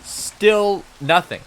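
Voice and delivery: exasperated groan of still nothing. Real, not acted. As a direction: drag it out in disbelief. Exasperated, slow, loud.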